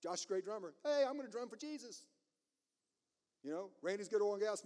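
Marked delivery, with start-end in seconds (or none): 0.8-2.1 high-pitched mock eagerness; eager volunteer. Drummer offering his gift to Jesus, eager volunteer. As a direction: pipe up like a keen helper.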